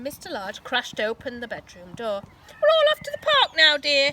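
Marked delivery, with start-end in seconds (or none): 2.5-4.1 high, loud cheerful call; loud announcement. A narrator's reading. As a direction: announce it to the whole house. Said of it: "he announced".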